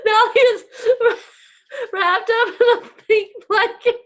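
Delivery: high, loud, laughing so hard it turns to tears; gasping the words out through real laughter; helpless laughter. Real, not acted. Telling viewers her husband's in a pink blanket, cracking up. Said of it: "I'm crying, y'all".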